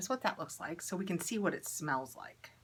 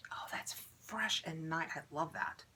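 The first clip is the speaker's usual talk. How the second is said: whisper; 6 dB quieter than usual. Real, not acted. Smelling the lotion, hushed.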